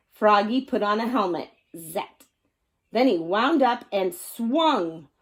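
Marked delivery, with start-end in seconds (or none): none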